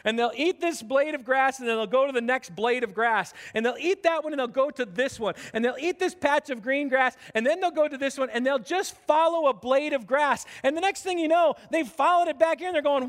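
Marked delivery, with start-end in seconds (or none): none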